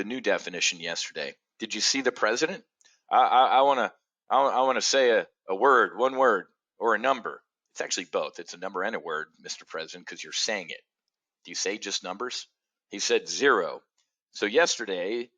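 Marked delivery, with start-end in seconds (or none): none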